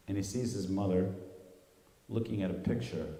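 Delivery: normal talking voice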